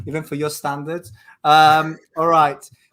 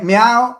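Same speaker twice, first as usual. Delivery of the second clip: spoken cat meow, higher and louder; mock meow; 6 semitones higher, 10 dB louder than usual. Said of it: "either meow or bark".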